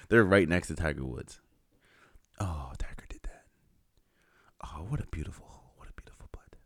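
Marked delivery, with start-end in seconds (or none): 2.4-3.4 hushed golf announcer whisper; golf commentator whisper. Retold or imitated. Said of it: "what are you whispering for". 4.6-5.6 whispered golf commentary; golf commentator whisper. Retold or imitated. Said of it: "what are you whispering for". 5.8-6.6 fading whispered golf call; golf commentator whisper. Retold or imitated. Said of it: "what are you whispering for".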